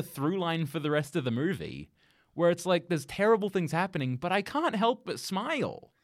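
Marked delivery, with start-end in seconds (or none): none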